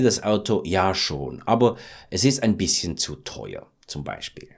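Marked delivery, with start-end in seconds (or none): none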